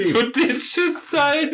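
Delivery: drawn-out falsetto; very high slow voice. Pretend. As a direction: stretch it slowly in falsetto. Mock high exclamation.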